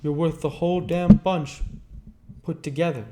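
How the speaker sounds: high, loud call across a lawn; shouting across lawn. A narrator's reading. Nick shouting to Gatsby across the lawn, loud. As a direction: shout it out over the grass. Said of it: "I shouted across the lawn".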